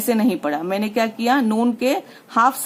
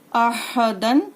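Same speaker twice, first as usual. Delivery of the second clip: अह दन drawn out very slowly; slow spelled reading; much slower than usual. Shown as a demonstration. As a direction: read अह दन syllable by syllable. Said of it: "हम कैसे पढ़ेंगे".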